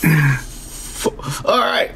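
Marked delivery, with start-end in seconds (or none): none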